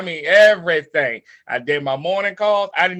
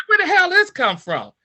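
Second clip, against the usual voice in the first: high, outraged disbelief; raising the voice in stunned protest; shocked; 11 semitones higher than usual. Retold or imitated. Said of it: "I'm like".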